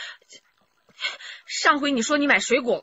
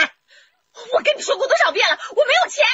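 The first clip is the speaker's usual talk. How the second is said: raised, higher-pitched refusal; exasperated refusal; 8 semitones higher than usual. Acted. Wife refuses her husband money, exasperated and raised. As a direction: shout him down.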